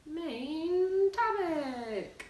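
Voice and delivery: high, slow sung-out title; drawn-out announcer. Acted. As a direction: stretch it out high like a jingle. Echoing segment title.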